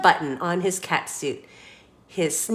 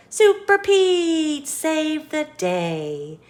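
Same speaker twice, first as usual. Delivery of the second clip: high, loud, slow hero proclamation; heroic announcer; 7 semitones higher, 3 dB louder, slower than usual. A narrator's reading. Closing line for the kids, slow heroic announcer.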